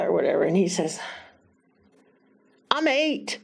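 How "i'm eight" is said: put-on little boy. Boy voiced in retold story.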